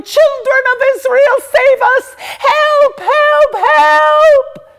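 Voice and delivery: screaming for help at the top of her lungs; full-throated, high panicked cry for rescue; a servant girl running to town, screaming for help; desperate yelling. Acted.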